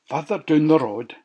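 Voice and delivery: usual speaking voice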